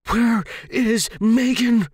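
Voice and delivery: high, loud, slow shout; shouted demand. Acted. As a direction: yell the question at her. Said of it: "I demanded".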